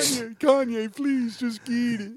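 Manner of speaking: mock pleading [name] plea. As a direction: coax him to eat it in a silly voice. Mock pleading fish ad scene.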